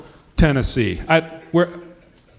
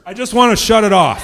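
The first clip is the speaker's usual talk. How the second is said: usual speaking voice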